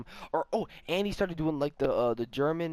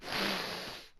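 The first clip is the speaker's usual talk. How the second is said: imitated sniff; 7 semitones higher, 4 dB quieter than usual. Teller imitates the man creeping up behind, sniffing. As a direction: sniff like someone smelling a person's back. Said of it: "I felt him go".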